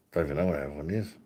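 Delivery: ordinary delivery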